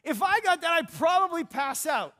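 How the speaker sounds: picture winning and almost passing out; excited. Shown as a demonstration. Preacher to congregation, showing how ecstatic they'd be. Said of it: "there would be an excitement".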